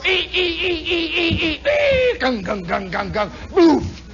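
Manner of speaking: high squeals dropping into chugging thuds; sputtering plane ending in a slow boof; failing engine noise. Retold or imitated. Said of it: "you'd see one go".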